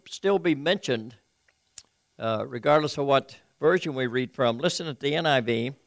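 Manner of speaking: ordinary talk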